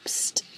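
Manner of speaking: a sharp breathy psst, barely any voice; whispered psst. A narrator's reading. Old lady hissing at Harold during the funeral, whispered psst. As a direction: hiss to get him to turn round.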